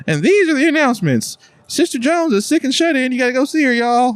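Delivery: high-pitched church announcement voice; imitating a church announcer, pitched far above normal talk. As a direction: call out the announcements to the congregation, way up high. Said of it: "How do you feel about saying the announcements".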